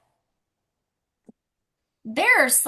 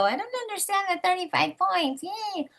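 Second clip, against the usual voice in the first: much higher, mocking a puzzled newbie; mock confused recruit; 9 semitones higher, 4 dB quieter than usual. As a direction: parody the confused customer with a sarcastic yay.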